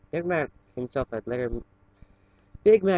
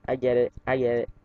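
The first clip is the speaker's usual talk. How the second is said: plain reading voice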